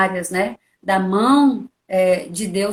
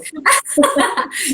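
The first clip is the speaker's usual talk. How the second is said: real laugh; 5 semitones higher than usual. Real, not acted. Laughing together.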